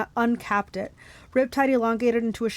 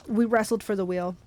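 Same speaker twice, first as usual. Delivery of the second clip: ordinary delivery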